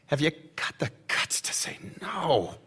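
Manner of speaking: hushed, pointed challenge; whispered challenge. Acted. Drunk guest dares the newspapermen, whispered challenge. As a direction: whisper it like a dare.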